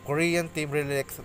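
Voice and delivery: ordinary delivery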